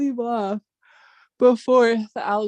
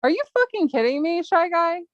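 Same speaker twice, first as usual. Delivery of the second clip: incredulous outburst; 7 semitones higher than usual. Real, not acted. Reading harassing messages, disbelief.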